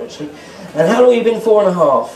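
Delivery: usual speaking voice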